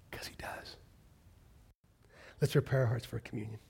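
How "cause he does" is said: faint whisper; almost silent assurance. Acted. Hushed final assurance.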